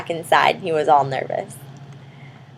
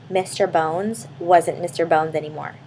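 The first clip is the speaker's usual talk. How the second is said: ordinary delivery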